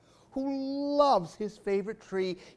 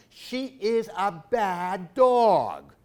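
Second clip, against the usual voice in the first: plain reading voice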